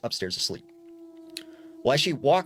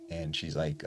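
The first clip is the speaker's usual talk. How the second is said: plain reading voice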